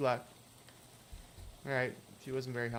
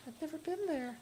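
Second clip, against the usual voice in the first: put-on much higher, thin ghostly girl voice; hitchhiker Lydia; 13 semitones higher, 3 dB quieter than usual. Hitchhiker asking the player for a ride, high ghostly voice. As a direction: play the strange girl in the rain begging a lift.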